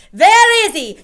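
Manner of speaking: very high, very loud witch snap; snapped. A narrator's reading. Grand High Witch hunting the lost mouse. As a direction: snap it impatiently. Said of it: "snapped the Grand High Witch".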